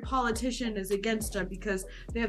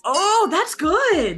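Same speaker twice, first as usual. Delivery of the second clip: loud, high, drawn-out delight; delighted approval; 7 semitones higher, 9 dB louder, much slower than usual. Real, not acted. Reacting to a co-host's accent, loudly delighted. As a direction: exclaim with real pleasure, stretched out.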